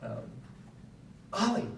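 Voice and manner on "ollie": sharp, high yell of a name; kids' yell. Retold or imitated. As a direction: imitate a kid yelling for a sibling. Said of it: "they just yelled his name".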